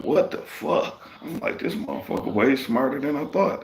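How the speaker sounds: high-pitched, incredulous reenacted reaction; retold amazement. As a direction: replay your astonishment, voice jumping up. Reenacts his amazement at the foreman.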